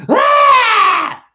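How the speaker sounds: high, loud imitated shriek; imitating a customer's sudden yell; mock customer scream. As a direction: let out a startled squeal.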